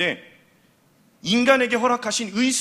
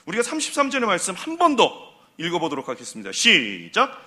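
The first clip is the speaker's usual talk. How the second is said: usual speaking voice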